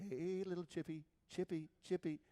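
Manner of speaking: dad sweet-talking a little pet; coaxing a pet. Retold or imitated. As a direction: call gently to a tiny animal. Dad calling his pet chipmunk.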